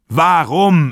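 „Warum?“ laut, aber tiefer, mit fallendem Schluss nachgesetzt; „Warum?“ fordernd hingeknallt, fallend; wütend betont. A narrator's reading. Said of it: "schäumend vor Wut". („Warum?“ als lauter, fallender Nachdruck nach dem hohen Schrei)

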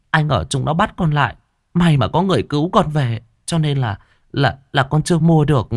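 put-on high, stammering timid girl's voice; frightened girl. Girl stammers her excuse to the stepmother, afraid. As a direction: stumble over the words in fear.

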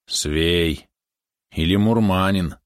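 drawn-out words, mildly disapproving; understated grumble of disapproval; disapproving mutter. A narrator's reading. Boy sizing up the foreign rider, disapproving mutter. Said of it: "неодобрительно пробормотал Добрый".

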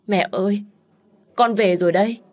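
cao và to, xúc động vỡ òa; gọi mẹ trong nước mắt; nghẹn ngào gọi mẹ. Acted. Con gái về gặp mẹ. (con gái nghẹn ngào gọi mẹ)

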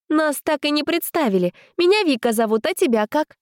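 put-on high eager girl voice; bright, eager introduction; girlish voice. A narrator's reading. Vika introducing herself.

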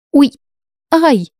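sharp high yelps of pain; sudden ouch, ouch; cry of pain. A narrator's reading. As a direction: yelp as if scraped raw. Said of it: "вскрикнула я".

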